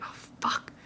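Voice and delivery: breathy whispered curse; whispered dismay. Retold or imitated. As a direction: whisper it like panic setting in. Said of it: "just be like".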